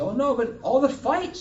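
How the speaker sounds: loud, high-pitched worried protest; high, loud storytelling reply; acted outcry. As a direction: protest loudly, pitched well up.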